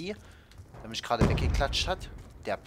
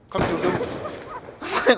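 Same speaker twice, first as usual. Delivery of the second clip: whispered urging; 4 semitones higher, 6 dB louder, much slower than usual. Real, not acted.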